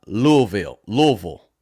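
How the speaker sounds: Louisville squashed to LOO-vul; local pronunciation. Shown as a demonstration. Host corrects himself, Louisville said the local way as LOO-vul. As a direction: drop the "ee", say Louisville as LUH-vul. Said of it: "They say Louisville".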